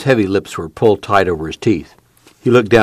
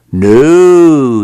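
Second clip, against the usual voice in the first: long, louder "No", swelling high; drawn-out denial; 6 semitones higher, 8 dB louder than usual. A narrator's reading. Tom reassuring his mother, long drawn-out "No". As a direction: stretch "No" out, pitched up.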